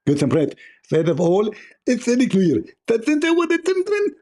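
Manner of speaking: very high, lisping mock preacher voice; mocking Zakir Naik. Parodying the rival preacher for the chat in a squeaky lisp. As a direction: squeak it out like a toothless lecturer. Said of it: "You will speak like Zakir Naik".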